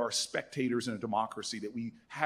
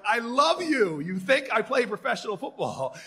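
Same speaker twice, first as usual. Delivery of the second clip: delighted laugh; 8 semitones higher, 5 dB louder than usual. Retold or imitated. Retold delight at football question.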